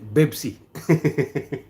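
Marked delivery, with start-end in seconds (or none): 0.0-0.6 "Bebsi" with a b for each p; local pronunciation of Pepsi. Retold or imitated. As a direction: say "Bebsi", voicing both p sounds into b. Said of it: "don't say Pepsi".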